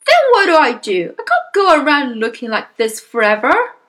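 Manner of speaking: loud, high desperate protest; panicking father. Acted. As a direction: plead loudly for a solution. Panicking father voiced in story.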